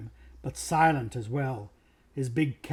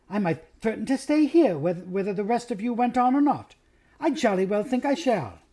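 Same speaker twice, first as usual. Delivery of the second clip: Susan's haughty threat; 6 semitones higher than usual. Acted. Susan sulking, threatening to stay.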